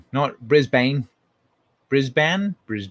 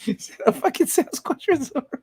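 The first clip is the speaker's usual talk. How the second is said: much higher squeaky retake; high mock voice; squeaky mock repeat; 13 semitones higher than usual. Shown as a demonstration. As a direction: parrot yourself in a silly squeak. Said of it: "repeats his line in a squeak".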